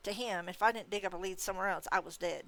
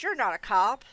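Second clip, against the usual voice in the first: accusing; 2 semitones higher, 9 dB louder than usual. A narrator's reading.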